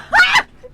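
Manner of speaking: high, loud laugh of pure hype; shrieking laugh. Real, not acted. Streamer laughing in disbelief at the reveal, shrieking. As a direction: let out a squealing laugh.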